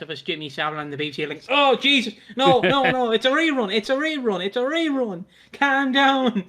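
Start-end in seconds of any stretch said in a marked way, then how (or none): 1.5-2.9 higher, hammed-up alarm; mock panic. Acting out horrified reaction to broadcast. 2.9-6.4 higher, insistent hammed-up pleading; mock panicked reassurance. Acting out panicked damage control.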